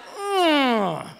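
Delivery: long strained "mm"; exasperated groan. Acted. God exasperated with his children, groaning. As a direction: hold back anger in a drawn-out grunt. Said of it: "I know he's like".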